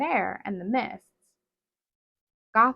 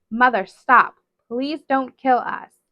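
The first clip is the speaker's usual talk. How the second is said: loud cry then slow begging; desperate plea; 3 semitones higher, 7 dB louder, slower than usual. Acted. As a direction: beg her mother desperately. Begging for sisters' lives.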